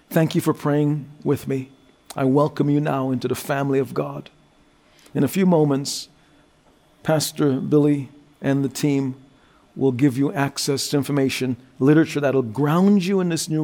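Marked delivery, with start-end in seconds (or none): none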